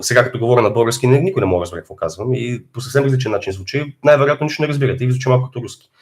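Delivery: rapid-fire Bulgarian speech; fast Bulgarian. Shown as a demonstration. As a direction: rattle off Bulgarian quickly. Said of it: "this was Bulgarian".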